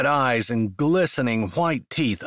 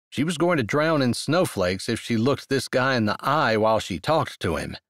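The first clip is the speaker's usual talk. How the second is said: plain reading voice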